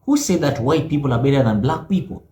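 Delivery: ordinary talk, nothing marked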